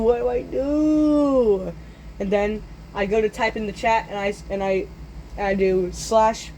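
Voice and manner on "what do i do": slow, higher, drawn-out wail; panicked wail. Retold or imitated. Boy helpless as the fire rises, long panicked wail. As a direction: stretch the last word into a mock wail.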